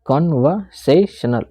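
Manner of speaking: Conversational slowly in four separate pieces; slow chunked conversational with small gaps; broken into syllables. Shown as a demonstration. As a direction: split conversational as con, ver, sa, tional. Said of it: "समझाने की कोशिश कर रहा हूँ".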